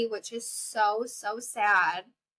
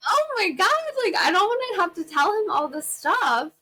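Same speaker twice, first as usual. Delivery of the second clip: mortified; 9 semitones higher, 5 dB louder than usual. Real, not acted. Mortified about the hot doctor.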